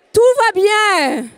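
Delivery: slow, weighty "Tout va bien."; slow emphatic reassurance. Shown as a demonstration. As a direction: lay down "Tout va bien." slowly, each word weighed. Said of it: "Et je dis". ("Tout va bien." dit lentement et avec poids)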